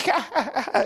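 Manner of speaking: a real high laugh breaking out mid-story; high burst of laughter. Real, not acted. Preacher laughing at the sister's story, a high laugh. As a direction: let a sudden high laugh escape.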